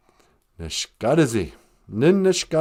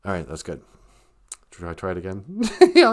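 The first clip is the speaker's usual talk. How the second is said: normal talking voice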